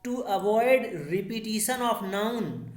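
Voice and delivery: slow, word-by-word read-out of the definition; teacher reading the written definition at dictation pace. Shown as a demonstration. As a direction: dictate the rule slowly. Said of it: "कह रहा है".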